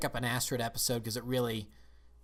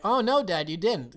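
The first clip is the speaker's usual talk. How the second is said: lightly acted son, higher and louder; mock son's reply; 8 semitones higher, 8 dB louder than usual. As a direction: play the son humouring his dad. Voices son answering boastful dad.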